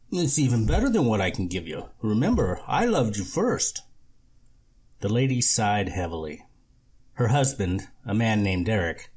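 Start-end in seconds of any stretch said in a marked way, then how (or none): 0.1-3.8 oily flattering repairman; slick con man. Acted. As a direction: lay on the charm. Lorne flattering the lady.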